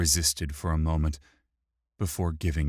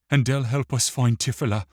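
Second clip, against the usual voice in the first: quick, high, loud plea; near begging; 7 semitones higher, 6 dB louder, faster than usual. A narrator's reading. Wick hopes for help finding Tifila, near begging. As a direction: press urgently, close to pleading. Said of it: "in a voice near begging".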